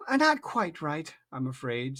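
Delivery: plain reading voice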